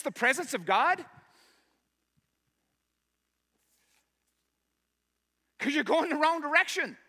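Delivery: a preacher at full force calling the church to stop running; full-force preaching. Acted. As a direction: drive it home to the congregation. Preaching climax to congregation.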